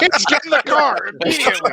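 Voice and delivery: high-pitched hammed-up parental order; mock alarmed mom. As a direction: play a mom urgently herding the kids. Voicing mom ordering kids away.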